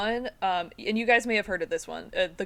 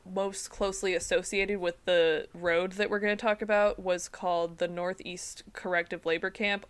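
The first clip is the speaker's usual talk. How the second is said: ordinary delivery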